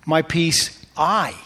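slow build to a stressed, drawn-out I. Shown as a demonstration. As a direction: land hard on the word I. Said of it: "Emphasize one word".

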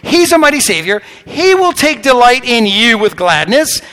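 loud, fast, high preaching. Acted. Preacher booming to the congregation, full force. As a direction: preach it out big.

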